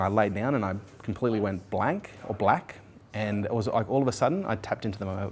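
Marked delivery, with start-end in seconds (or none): none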